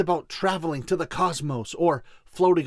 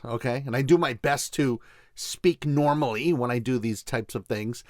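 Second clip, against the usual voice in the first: ordinary delivery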